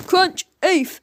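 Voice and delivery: a grunt of pain after the crunch; shouted oof. A narrator's reading. Mister Big hit on the chin, grunting in pain. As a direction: groan as the cabbage hits. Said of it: "shouted the man".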